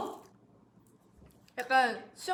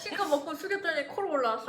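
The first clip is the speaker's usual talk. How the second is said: laughing; 4 semitones higher, 3 dB louder than usual. Real, not acted.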